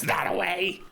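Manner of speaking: high little creature giving directions; put-on high creature pointing the way; [name]'s creature voice. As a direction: call it out high from someone's shoulder. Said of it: "goes onto your shoulder and goes".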